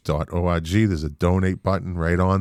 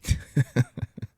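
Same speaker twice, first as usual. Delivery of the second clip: quick unvoiced laugh; short airy laugh; breathy laugh; 4 semitones higher than usual. Real, not acted. Laugh after plea.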